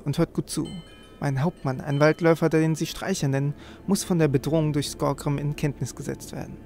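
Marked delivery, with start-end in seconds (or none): none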